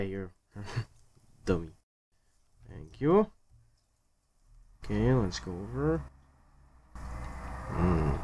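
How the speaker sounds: ordinary delivery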